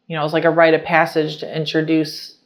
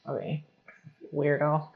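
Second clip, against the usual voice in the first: dismissive sneer; 11 dB quieter than usual. Retold or imitated. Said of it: "I'm like".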